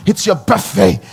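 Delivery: usual speaking voice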